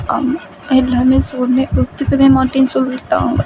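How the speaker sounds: put-on high, begging girlfriend voice; acted helpless begging; tearful pleading. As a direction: plead to be taken away, frightened. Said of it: "நீங்க கெஞ்சிக்கிட்டு இருக்கீங்க".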